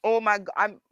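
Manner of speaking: usual speaking voice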